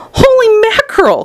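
very high exclamation of shock; startled cry. A narrator's reading. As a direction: burst out in high-pitched alarm. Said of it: "cried Mister T V".

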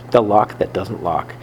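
plain reading voice

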